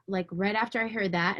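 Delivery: usual speaking voice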